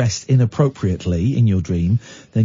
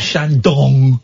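mock Shandong, DONG drawn out and loud; exaggerated Shandong with a long, heavy DONG; stress on DONG; 5 dB louder than usual. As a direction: lean hard and slowly on the DONG of Shandong. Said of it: "emphasising the word dong".

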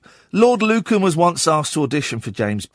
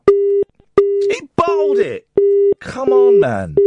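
high, loud, drawn-out wail of disbelief; 1 mock outrage; 5 dB louder, much slower than usual. Real, not acted. DJ wailing when a caller hangs up, mock dismay. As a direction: stretch it out in shocked dismay.

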